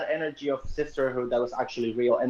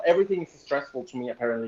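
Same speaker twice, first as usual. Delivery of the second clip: ordinary talk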